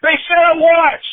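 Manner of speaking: put-on high, loud excited kid yelling; reliving the moment at the top of the voice; outraged realization. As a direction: shout it like a fan who sees the trick. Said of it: "I'm like".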